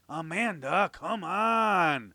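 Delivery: drawn-out pleading whine; whining. A narrator's reading. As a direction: whine at her to hurry. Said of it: "Josh whined".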